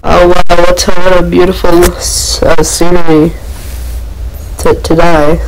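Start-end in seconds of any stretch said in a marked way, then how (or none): none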